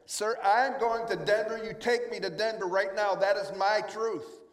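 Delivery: loud, high demanding yell, slowing at the end; hammed-up demand, planting "That is my truth"; demanding shout. Passenger shouting at the attendant, high loud mock demand.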